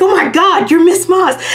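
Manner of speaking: high, loud starstruck squeal; recognizing an online friend in an elevator, high excited squeal; excited recognition. Retold or imitated. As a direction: gush at meeting a favourite artist. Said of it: "I was like".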